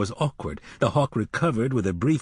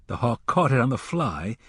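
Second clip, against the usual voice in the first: ordinary delivery, nothing marked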